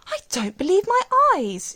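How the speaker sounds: high, loud cry of amazed joy; delighted cry. A narrator's reading. Old woman on the hilltop, crying out in amazed delight. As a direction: gasp it out in wonder at the view. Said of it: "she cried".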